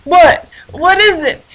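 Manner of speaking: startled waking. Acted. Harold the hippo suddenly woken, startled. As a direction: snap awake confused.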